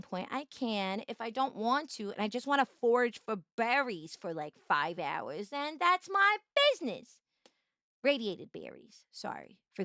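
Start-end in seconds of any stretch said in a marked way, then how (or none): none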